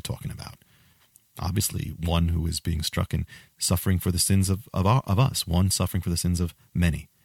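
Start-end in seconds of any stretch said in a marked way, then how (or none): none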